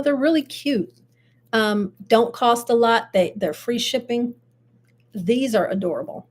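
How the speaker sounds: ordinary talk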